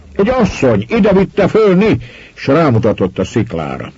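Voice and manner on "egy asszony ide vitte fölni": high, urgent shout; agitated explanation. A narrator's reading. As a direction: blurt it out to the police, high-pitched. Victim shouting explanation from water. (izgatott, magas hangú magyarázat)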